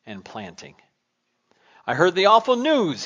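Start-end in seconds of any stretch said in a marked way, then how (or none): 1.8-3.0 called out across the field, louder and higher; a reporter hailing a man in the field; calling out. Retold or imitated. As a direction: call out to him from a distance. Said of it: "he called to Clarence".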